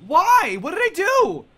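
high, loud cries of disbelief; outraged shouting. Real, not acted. Player just got shot in the game, outraged shouting. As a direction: shout it, stunned at the injustice.

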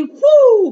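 big high whoosh of wind; loud blowing whoosh. Acted. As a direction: blow the house down with one huge gust. Coyote blows house down.

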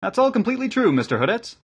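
high-pitched youth; young lad, pitched well up; village lad. A narrator's reading. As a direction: back up the story in a high boy's voice. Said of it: "said the lad".

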